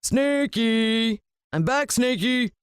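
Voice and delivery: high, slow sing-song villain call; gloating villain. Acted. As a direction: croon to the pet snake, greedy and gleeful. Villain calls snake again.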